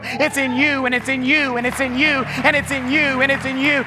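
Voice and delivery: full-force preaching. Acted. Pastor rallying his church on video, preaching loud and high. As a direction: preach it out, building and driving each line home.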